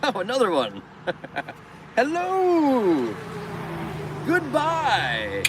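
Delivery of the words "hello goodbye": high, falling hello then goodbye; high drawn-out mock-royal calls; singsong greeting. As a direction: wave like the queen to traffic. Waving greeting to passing traffic.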